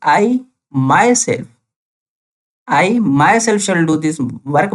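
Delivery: myself drawn out slowly and pressed hard; very slow, punching myself to show emphasis; stress on myself. Shown as a demonstration. Teacher showing students the emphasis, myself stressed. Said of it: "मैं emphasise कर रहा हूँ".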